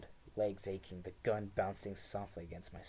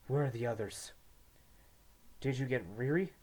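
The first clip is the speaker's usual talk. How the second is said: anxious, raised asking; urgent questions; 4 semitones higher, 4 dB louder than usual. A narrator's reading. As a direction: press for news urgently. Twins anxiously question French.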